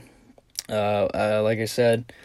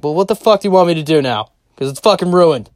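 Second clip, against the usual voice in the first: loud, high, exasperated retort; re-enacted heated comeback, raised pitch; defensive outburst; 6 semitones higher, 7 dB louder than usual. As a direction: fire it back loud and frustrated. Guilty, defensive retort to sister, retold.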